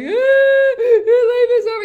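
high, loud, wailing mock sob; hammed-up howling cry over nothing; mock ugly cry. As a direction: wail it like your life just ended, half-joking. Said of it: "really ugly cries".

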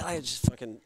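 ordinary talk, nothing marked

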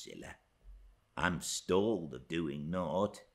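hushed, low, halting request; whispered. A narrator's reading. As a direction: ask softly, as if not to be overheard. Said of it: "Earnshaw crossed over and whispered to me".

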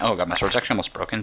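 normal talking voice

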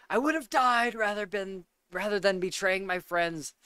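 a hint of Sirius's fury; intense Sirius. Previewing the quote before playing audio, mild intensity. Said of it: "another one of those".

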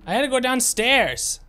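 high, outraged "go" falling hard; indignant whine. Acted. Gamer finding an empty room, high indignant whine. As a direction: sound wronged by the game. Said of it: "indignant at empty room".

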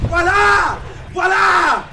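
shouting to finish him off. Real, not acted. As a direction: yell it, urging the kill. Urging finishing move in game.

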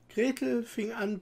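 ordinary delivery, nothing marked

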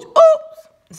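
high, loud little "oops"; a startled high-pitched slip; sheepish oops. A narrator's reading. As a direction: pop it out high. Froggy caught out by dad's reply.

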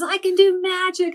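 high, loud proud claim; boastful child. Acted. Anton boasting to Luke, proud and loud. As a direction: brag it out.

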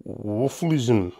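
puzzled repeat of a strange word; confused. A narrator's reading. Hamish repeating a word he hadn't heard, confused. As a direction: sound it out, baffled. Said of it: "Hamish repeated, confused".

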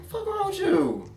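put-on can't believe what the kid did; high incredulous scold. Teacher to misbehaving child, high disbelief.

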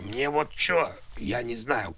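ordinary talk

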